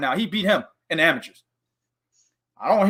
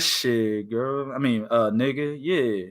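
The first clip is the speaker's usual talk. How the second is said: low, soft, slow crooning; a low, soft mock of a smooth talker; smooth macking voice; 9 semitones lower, 7 dB quieter, slower than usual. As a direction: purr it like a ladies' man.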